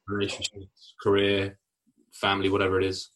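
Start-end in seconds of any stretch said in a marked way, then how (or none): none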